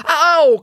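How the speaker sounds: loud, high "ow" of complaint; pained yelp. A narrator's reading. Man complains as the headlock tightens, yelping in pain. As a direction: cry out as if squeezed. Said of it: "complained the man".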